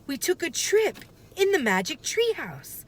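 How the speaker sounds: put-on excited young sister; Annie, lightly voiced. Annie explaining the trip to her brother, light girl voice.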